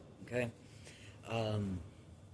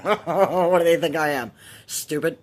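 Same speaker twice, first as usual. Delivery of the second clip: loud, laughing scoff; 6 semitones higher, 11 dB louder than usual. Real, not acted. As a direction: laugh it off in disbelief. Laughing at the tarot callers.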